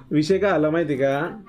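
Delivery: ordinary talk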